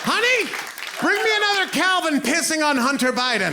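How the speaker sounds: high hollering truck-bed yell. Retold or imitated. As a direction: holler it like a good old boy in his pickup. Redneck yelling from truck bed.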